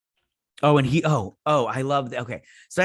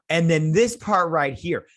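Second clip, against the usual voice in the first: ordinary talk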